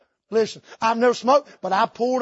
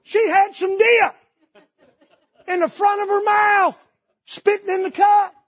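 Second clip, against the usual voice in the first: excited disbelief; 10 semitones higher, 5 dB louder than usual. Real, not acted. Disgusted amazed telling.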